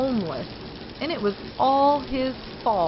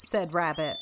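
ordinary delivery, nothing marked

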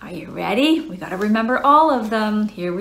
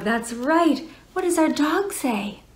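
usual speaking voice

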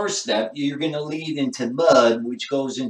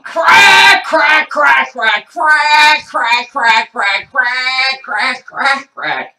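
very high, loud squawked "crack"; ducks quacking "crack"; 9 semitones higher, 11 dB louder, much slower than usual. Retold or imitated. Gold-chained ducks demanding crack, loud quack-like squawking. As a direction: squawk CRACK like a crazed duck. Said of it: "they're saying".

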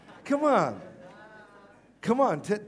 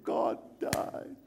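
hushed, breath-only mock weeping; mock tearful; 6 dB quieter than usual. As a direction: imitate a wife whimpering it softly. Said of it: "she started to cry".

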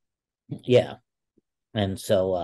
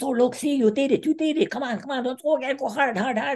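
cheering coach. Retold or imitated. Coach cheering a takedown he gave away, very high and playful. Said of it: "He goes".

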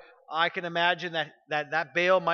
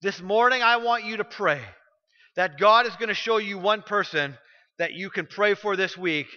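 louder, higher preaching push; passionate preaching; 3 semitones higher, 4 dB louder than usual. Acted. Pastor urging the church to bring their sins, fervent preaching. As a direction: drive the altar call home. Closing altar call.

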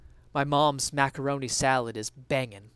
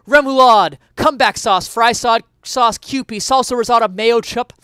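higher, much louder rapid-fire list; excited list; 7 semitones higher, 11 dB louder than usual. Acted. Essayist celebrates mayo sauces, higher and much louder. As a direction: rattle them off with gusto.

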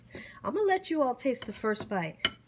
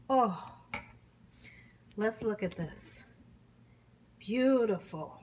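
ordinary talk

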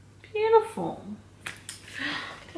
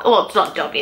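normal talking voice

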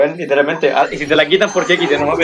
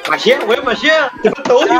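excited urging; 8 semitones higher than usual. Real, not acted. Urging friend in the game.

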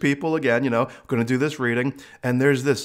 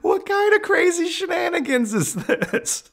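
high, laughing mock complaint; incredulous laughing protest; laughing disbelief; 10 semitones higher than usual. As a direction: laugh through a stunned complaint. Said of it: "I'm like".

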